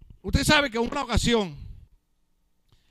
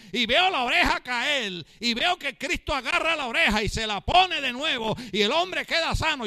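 raised-pitch, driving sermon delivery; impassioned preaching; 5 semitones higher than usual. Real, not acted. Preacher urges surrender at Christ's feet, impassioned preaching. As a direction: preach it high and insistent, phrase piling on phrase.

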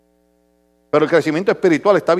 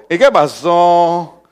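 mock drawn-out question; 5 semitones lower, much slower than usual. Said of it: "yo le digo".

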